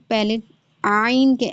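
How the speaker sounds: normal talking voice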